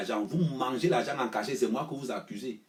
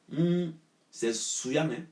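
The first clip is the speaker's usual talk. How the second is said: slow, higher scornful oh, c'est souillant; scornful drawl; 6 semitones higher, slower than usual. Acted. Scolding party rivals, a slow disgusted drawl. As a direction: stretch it out in disgust.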